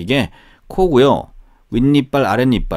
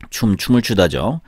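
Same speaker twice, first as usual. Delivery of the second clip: ordinary talk, nothing marked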